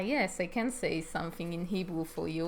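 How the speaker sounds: ordinary talk, nothing marked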